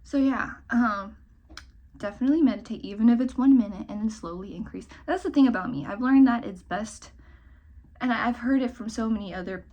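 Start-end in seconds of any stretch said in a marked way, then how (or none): none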